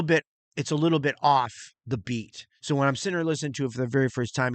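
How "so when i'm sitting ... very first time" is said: ordinary talk, nothing marked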